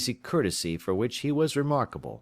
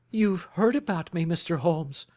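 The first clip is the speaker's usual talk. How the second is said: startled cry; 10 semitones higher than usual. Acted. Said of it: "she cried".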